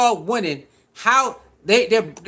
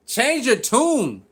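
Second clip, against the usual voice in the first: mock drawn-out taunt; 3 semitones higher, much slower than usual. Mocking the pundits.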